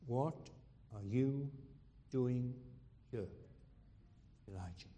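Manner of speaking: each word spaced out, fading to a hush on Elijah; searching, measured divine question; slow challenge. Retold or imitated. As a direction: drop to a near whisper as you ask. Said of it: "God challenges him".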